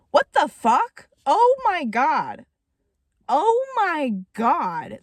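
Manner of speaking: high, drawn-out disbelief; appalled shock. Real, not acted. Reader reacting to Welly's gruesome death, appalled shock. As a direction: stretch each exclamation, swooping down from high.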